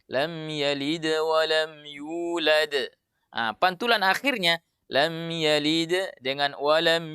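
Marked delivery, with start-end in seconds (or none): 0.1-2.7 slow recitation flowing straight through "wa lam yulad"; joined recitation. Shown as a demonstration. Teacher showing the correct joined reading. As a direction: join the words smoothly without pausing.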